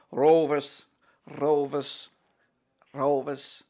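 practising an imitated accent on "Rovers"; lightly imitated heavy accent, word by word; JDT. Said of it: "practice my J D T accent".

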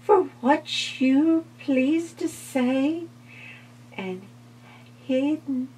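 very high, breathy fly voice; polite little fly. Acted. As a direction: thank him in a tiny voice. Fly takes leave.

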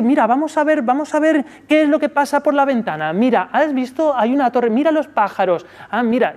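put-on high, bright coaxing voice to pull the child's eye; upbeat distracting parent. Shown as a demonstration. Parent distracting an upset toddler, high coaxing voice. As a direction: point the child to the window, sing-song and high.

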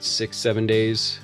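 ordinary delivery

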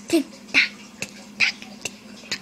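breathy whispered tick tock; whispering. Pretend. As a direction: whisper it, fading away.

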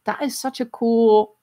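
ordinary talk, nothing marked

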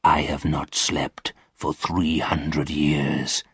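low, slow, soft lament; a mournful old ghost, drawn out; weary ghost. A narrator's reading. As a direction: speak low and slow, worn out. Sleepless ghost tells his sorrow.

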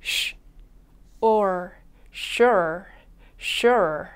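"sure" built up sound by sound: sh, then or, then the whole word twice; 소리를 나눠 보여주는 sure. Shown as a demonstration. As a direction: break "sure" into sh and or, then blend it. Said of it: "함께 연습해 주세요".

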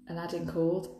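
usual speaking voice

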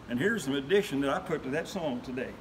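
usual speaking voice

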